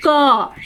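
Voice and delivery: "क" drawn out so its trailing vowel sounds; pronunciation demo. Shown as a demonstration. Teacher shows the vowel heard inside "क", drawn-out demo. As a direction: say "क" alone, holding the vowel after it. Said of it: "आइए हम देखें उच्चारण करके".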